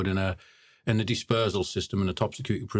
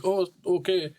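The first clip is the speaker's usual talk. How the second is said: higher mock "Oh, okay" of a lad caught off guard; imitating startled lad; 9 semitones higher than usual. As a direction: squeak it out like someone who didn't see it coming. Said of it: "he thought it was gonna be like".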